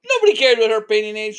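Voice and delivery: fed-up outraged retort; indignant echo. Real, not acted. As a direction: throw the line back, indignant. Narrator echoes line in outrage.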